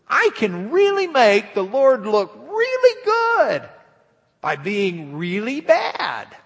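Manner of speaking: high, loud hammed-up glee; mock gleeful sinner. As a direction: play the giddy sinner who thinks sin is fine. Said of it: "some of you may be sitting back saying".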